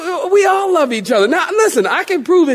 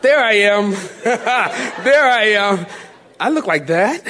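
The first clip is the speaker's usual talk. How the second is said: laughing self-recognition. Pretend. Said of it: "Who is the first person you look for".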